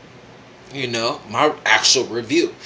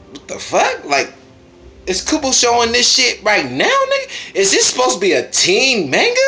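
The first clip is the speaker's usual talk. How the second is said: much higher, in shocked disbelief; incredulous; 10 semitones higher than usual. Real, not acted. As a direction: squeak out your outrage at the page. Disbelief at racy panels.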